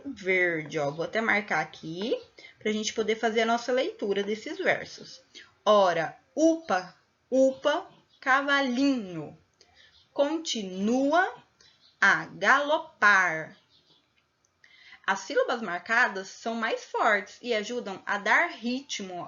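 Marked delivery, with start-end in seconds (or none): 5.6-13.6 slow verse, punching O in "Ora", U in "upa", LI in "cavalinho"; stressed tonic syllables. Shown as a demonstration. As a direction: hit each stressed syllable hard, down to PAR in "galopar". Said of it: "essas sílabas que a gente falou com mais intensidade". (sílabas tônicas bem marcadas em "Ora, upa, upa, cavalinho")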